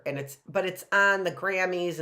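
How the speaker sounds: ordinary talk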